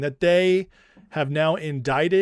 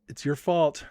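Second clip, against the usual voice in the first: ordinary delivery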